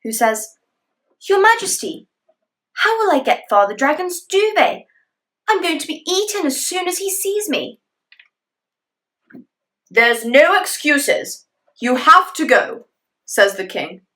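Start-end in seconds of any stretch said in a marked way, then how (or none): 1.3-7.8 put-on scared boy. Tradishino pleading, afraid. 9.9-12.9 stern king. Acted. King insisting.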